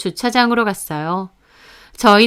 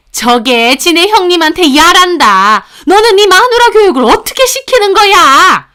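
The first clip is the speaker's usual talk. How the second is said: loud, high-pitched outraged rant; furious scolding; 8 semitones higher, 7 dB louder than usual. Acted. Mother berating her son about his wife, furious shouting. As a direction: rage at him, voice climbing high.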